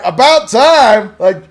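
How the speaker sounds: loud, slow, sarcastic cheer; mock congratulations. Son teasing his dad about remembering dreams, loud mock cheer. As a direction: cheer him on with a big, slow, mocking hooray. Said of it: "And I'm sitting there going".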